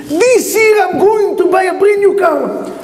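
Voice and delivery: son's gleeful brag; excited shout. Retold or imitated. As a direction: belt it out like a thrilled son. Son excitedly announcing new car.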